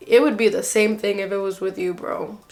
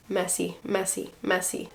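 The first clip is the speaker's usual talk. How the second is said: muttered disapproval; 2 semitones lower, 10 dB quieter than usual. Retold or imitated.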